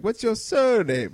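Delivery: slow, high bad Irish accent; badly faked Irish accent; Irish boss. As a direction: imitate an Irish boss, drawn out. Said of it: "a boss who was Irish".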